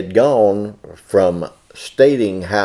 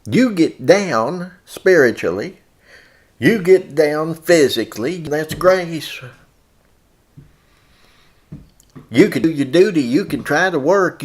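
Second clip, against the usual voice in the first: full-force preaching; 6 semitones higher than usual. Acted. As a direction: preach it hard to the brethren.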